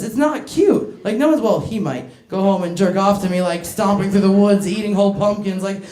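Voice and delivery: usual speaking voice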